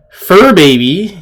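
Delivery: ordinary delivery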